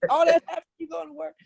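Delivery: incredulous father. Retold or imitated. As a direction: voice the father who can't believe it. Father's incredulous question retold.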